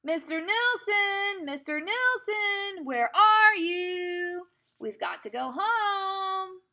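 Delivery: high, long drawn-out holler; shrieking call. Acted. Pippi calls her monkey in the woods, shrieking. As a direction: call out across the woods, stretching each word. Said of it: "she was shrieking at the top of her voice".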